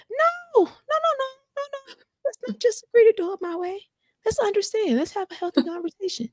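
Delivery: very high, squeaky pleading; mock protest. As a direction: protest in a high, put-on voice mid-argument. Acted quarrel, refusing to give in.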